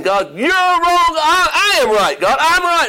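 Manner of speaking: high-pitched, acted defiant outburst; indignant protest. Jonah telling God he is wrong, indignant. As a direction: argue back hotly, pitch leaping up.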